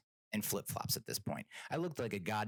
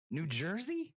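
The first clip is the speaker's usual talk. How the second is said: high, rising disbelief; baffled, exasperated question; incredulous; 10 semitones higher, slower than usual. Retold or imitated. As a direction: echo it as absurd, pitched up. Incredulous repeat of driver's question.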